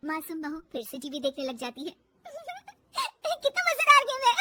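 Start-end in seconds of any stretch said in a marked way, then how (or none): 2.3-4.4 high, loud exclamation wrapped in laughter; very high laughing bahu; giggling delight. Acted. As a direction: gush between peals of giggles.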